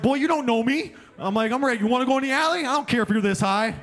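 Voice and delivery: hammed-up tough guy picking a fight; mock anger. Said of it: "compassion to anger pretty quick".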